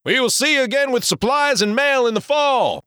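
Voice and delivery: high call from the helm. Acted. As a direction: call back to the shore. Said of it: "Ben called from the helm".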